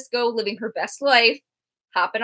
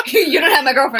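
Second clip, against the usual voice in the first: laughing; 2 semitones higher, 4 dB louder, faster than usual. Retold or imitated. Laughing while voicing Jughead.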